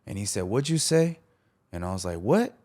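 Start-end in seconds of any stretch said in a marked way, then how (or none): 0.4-1.2 retold challenge. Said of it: "then I got pissed". 2.2-2.5 high-pitched, retold confrontational "what"; heated challenge. Teller squaring up to a player on court, heated challenge. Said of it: "it got heated".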